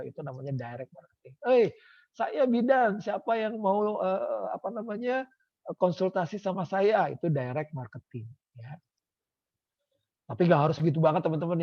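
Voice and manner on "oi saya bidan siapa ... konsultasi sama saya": calling out. Acted. Midwife calls out at party.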